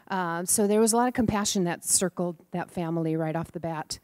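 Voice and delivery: usual speaking voice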